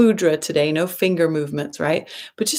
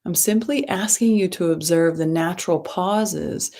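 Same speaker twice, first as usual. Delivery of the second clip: guided meditation. Acted. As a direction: ease them into the breath, gently and unhurried.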